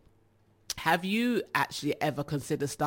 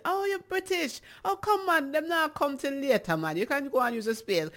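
much higher, lively Jamaican patois; Jamaican vendor; 9 semitones higher than usual. Retold or imitated. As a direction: imitate a vendor suddenly welcoming a Brit. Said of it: "As soon as they hit".

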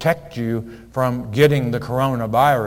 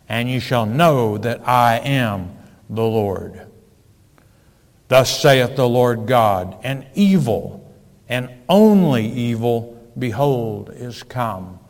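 scripture reading; 3 dB louder than usual. Acted. Reads scripture with force.

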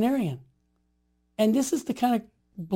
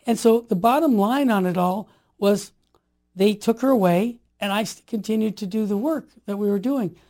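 usual speaking voice

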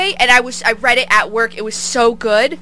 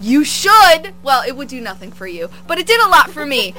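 ordinary talk, nothing marked

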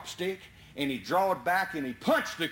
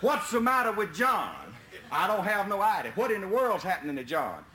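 ordinary talk, nothing marked

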